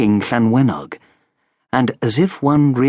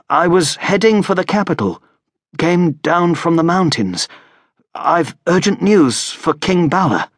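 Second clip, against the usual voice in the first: Errol, weak and wary; 6 semitones higher than usual. A narrator's reading. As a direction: play the injured man spinning a cover story.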